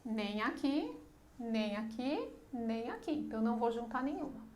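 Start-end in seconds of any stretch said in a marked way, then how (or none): none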